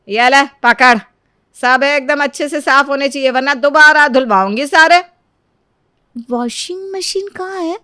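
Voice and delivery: loud, harsh scolding; scolding mother-in-law. Acted. Mother-in-law scolds the bride over the washing machine, loud and harsh. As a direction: bark orders at the daughter-in-law.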